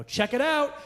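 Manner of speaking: high, loud mock-proud shout; excited announcement. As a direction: crow it like big baby news. Acted announcement to his wife.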